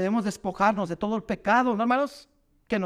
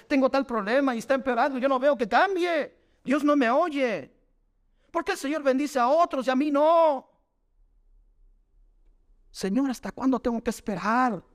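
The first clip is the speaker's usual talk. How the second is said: higher, slowing lament of a believer who feels unheard; higher, dragging complaint to God; voiced lament; 5 semitones higher than usual.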